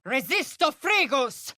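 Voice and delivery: high ringing incantation; sharp arcane cry; spellcasting. Acted. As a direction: cast the spell aloud.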